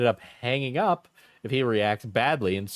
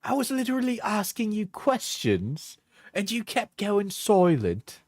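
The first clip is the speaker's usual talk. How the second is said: higher-pitched mock British accent; put-on accent; 5 semitones higher than usual. Reading breakup texts back, high put-on British accent. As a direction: act the texts out in exaggerated British. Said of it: "when I'm doing the accent".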